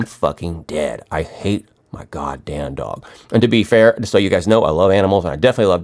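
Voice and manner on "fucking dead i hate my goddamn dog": seething. Real, not acted. Venting about barking dog.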